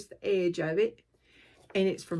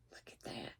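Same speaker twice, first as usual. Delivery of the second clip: soft breathy whisper; 21 dB quieter than usual. Real, not acted. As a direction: hush it to a secret. Showing a chart, suddenly hushed.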